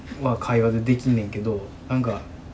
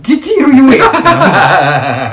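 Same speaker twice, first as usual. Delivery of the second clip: laughing shout; 10 semitones higher, 12 dB louder than usual. Retold or imitated. Self-mocking outburst, laughing.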